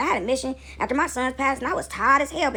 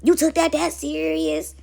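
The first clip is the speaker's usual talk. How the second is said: slow, raised, drawn-out mock protest; mock whiny excuse; backpedaling takers; 5 semitones higher, slower than usual. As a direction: stretch it out, feigning innocence. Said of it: "They like".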